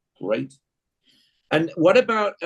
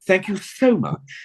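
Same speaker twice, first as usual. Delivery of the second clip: slow, higher, gushing English thanks; exaggerated English accent; 6 semitones higher, slower than usual. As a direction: overdo the English accent, drawing out "so". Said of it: "she does an impression of me".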